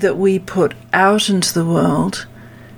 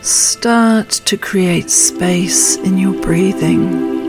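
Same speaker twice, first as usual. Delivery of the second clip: calm grounding exercise. Acted. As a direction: guide them gently into stillness. Leads a meditation.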